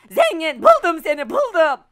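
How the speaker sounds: loud triumphant found-you cry; excited shout. Real, not acted. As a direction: shout in triumph at finding him. Finds the hider, triumphant.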